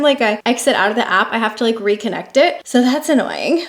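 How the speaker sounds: normal talking voice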